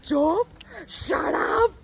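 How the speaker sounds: high, mock angry outburst; imitating scolding dad. Retelling a dad scolding his son, high mock scolding. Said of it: "the dad अपने बेटे को डांट रहा था".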